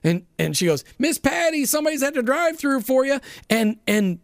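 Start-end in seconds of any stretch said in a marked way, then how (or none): 1.0-3.2 put-on weak Southern accent. Drive-thru young lady calling Miss Patty to the window, weak Southern accent. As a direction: imitate a Southern drive-thru worker, hammed up lightly. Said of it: "Southern explanation or Southern voice".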